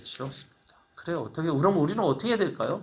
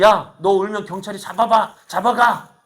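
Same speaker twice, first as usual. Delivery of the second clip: louder, higher mock warning to a child; mother scolding; 6 semitones higher, 8 dB louder than usual. Mother warns her crying child about the police, louder and higher. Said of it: "저 어머니가 하는 말이".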